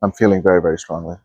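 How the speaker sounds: soft, low meditation voice; guided meditation. Acted. Coach guiding viewers to feel their field, hushed and calm. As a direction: talk them into calm, gently.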